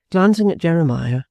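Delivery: ordinary delivery, nothing marked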